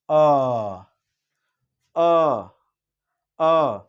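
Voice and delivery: अ said long and separately; vowel demo. Shown as a demonstration. Teacher sounding out the central vowel, drawn out. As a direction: hold each अ clearly. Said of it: "ये बीच का हिस्सा इसमें काम कर रहा है".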